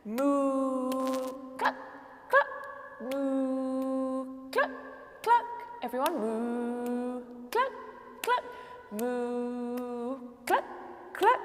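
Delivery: rhythmic moo and clucks; animal noises. Shown as a demonstration. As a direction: chant moo and cluck to the beat. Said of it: "Now let's do this one".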